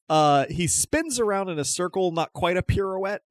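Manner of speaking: normal talking voice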